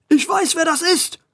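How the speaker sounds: high, angry outburst; angry yell. A narrator's reading. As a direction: shout it in outrage. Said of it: "schrie Schrankwand zwei erzürnt".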